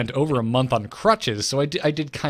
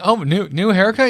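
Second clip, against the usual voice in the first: higher, louder nosy onlooker; mock surprise; 7 semitones higher, 5 dB louder than usual. Host voicing everyone noticing the haircut, mock surprise. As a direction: imitate people exclaiming over a change.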